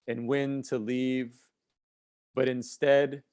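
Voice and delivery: slow, measured poem recitation with long pauses; spoken-word poem, unhurried and deliberate; poetry reading. Acted. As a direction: recite it line by line, letting each phrase hang.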